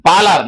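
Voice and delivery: Palar with a long open first A; correct pronunciation. Shown as a demonstration. Lecturer correcting a river's name for the class, correct pronunciation of Palar. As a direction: stress the drawn-out PAA in Palar. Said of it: "not Polar".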